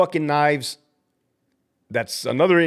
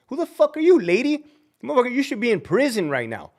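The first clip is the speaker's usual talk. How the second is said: angry outburst; 8 semitones higher than usual. Real, not acted. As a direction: let it burst out at her. Ranting at the director.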